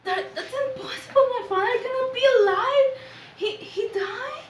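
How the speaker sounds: high, fast, half-laughing through tears; tearful disbelief. Acted. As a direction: break down in shocked, teary disbelief. Daughter hears her father lives.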